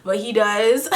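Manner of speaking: usual speaking voice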